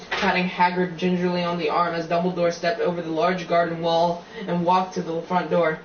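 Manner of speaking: ordinary delivery, nothing marked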